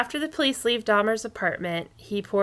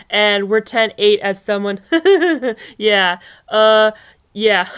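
giggling through the words, louder, mock amusement; imitating laughing cops; 8 dB louder than usual. Host mimicking the cops on the tape, laughing. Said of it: "they laugh about it".

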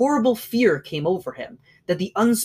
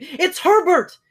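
even higher, louder scream; 12 semitones higher, 6 dB louder, slower than usual. A narrator's reading. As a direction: scream it again, wilder. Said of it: "she screamed".

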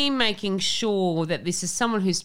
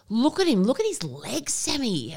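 higher, gushing mum voice over the weatherman; mum swooning; 6 semitones higher than usual. Retold or imitated. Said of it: "She goes".

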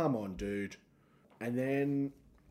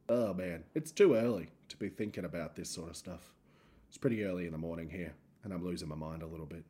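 ordinary talk, nothing marked

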